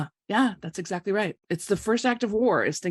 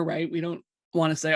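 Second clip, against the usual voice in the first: usual speaking voice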